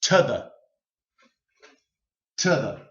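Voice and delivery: "To the" run together as one word on schwa, "tuh-thuh"; linked to the. Shown as a demonstration. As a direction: blend "To the" into a single quick word. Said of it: "we're gonna say it like one word".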